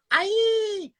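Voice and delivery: high, stretched "aye"; schwaz impression. Shown as a demonstration. Host shows off favourite schwaz catchphrase, high impression. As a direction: hold the vowel long in a high schwaz imitation. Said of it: "I love his".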